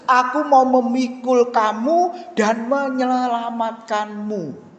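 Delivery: slow, solemn reading of a Bible verse; scripture reading. Acted. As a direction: read the verse slowly aloud with the congregation. Reads Isaiah verse with congregation.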